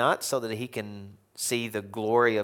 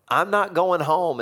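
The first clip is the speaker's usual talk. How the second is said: high, slow, louder pouting refusal; sulky Jonah; 8 semitones higher, 6 dB louder, slower than usual. Acted. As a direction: plead it like a stubborn prophet. Preacher voices sulking Jonah.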